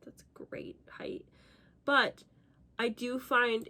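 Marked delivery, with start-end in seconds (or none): 0.1-1.3 slow whispered aside. Real, not acted. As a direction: drop to a whisper. Aside about height.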